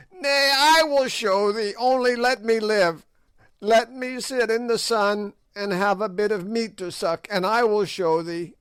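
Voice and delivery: high, loud crone's voice begging for life; desperate witch pleading. A narrator's reading. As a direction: beg like a terrified old hag. Gagool begs for her life.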